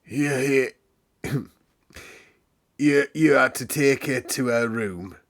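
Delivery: put-on a stammering old manservant, a touch husky; old butler stammering his orders, husky. A narrator's reading. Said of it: "he said in a husky voice".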